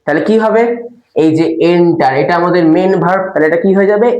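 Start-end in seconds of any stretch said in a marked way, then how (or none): none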